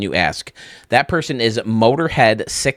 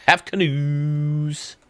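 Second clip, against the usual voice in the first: slow delighted drawl; 5 semitones higher, much slower than usual. Real, not acted. Delighted by the canoe props.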